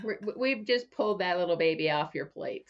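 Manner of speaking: ordinary talk, nothing marked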